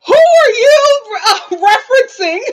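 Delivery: high, loud, slow cry through laughter; loud incredulity breaking into laughter; incredulous laughing outburst. Real, not acted. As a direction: shriek the question, cracking up. Mocks the bad accent, cracking up.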